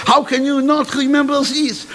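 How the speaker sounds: put-on high, French-accented disbelief; persistent headmistress. Headmistress presses forgetful student, high and persistent. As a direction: plead the point in a high voice. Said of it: "she persisted".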